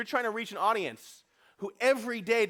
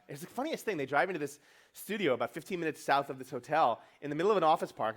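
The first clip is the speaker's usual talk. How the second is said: ordinary delivery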